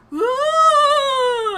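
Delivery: high, loud "woo" held long as he drops; long high wail of a falling body; falling scream. Acted. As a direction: wail loudly all the way down. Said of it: "as he falls".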